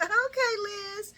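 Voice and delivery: high, loud hyped-up reaction; impressed cheer. Retold or imitated. Viewer reacting to Liz's kindness on the show, impressed cheer.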